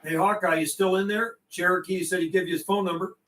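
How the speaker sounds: normal talking voice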